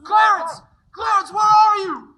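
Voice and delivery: loud, high shout into the night; desperate calling. Acted. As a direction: call out for the angel, frantic. George calls out on bridge.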